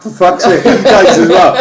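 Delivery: high, loud, cracking up; loud laughing outburst. Real, not acted. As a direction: laugh through the words. Joking about a sad film.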